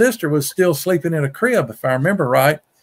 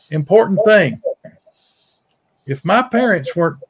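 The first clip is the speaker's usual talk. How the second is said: normal talking voice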